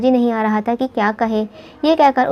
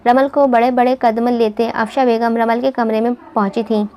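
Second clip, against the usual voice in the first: usual speaking voice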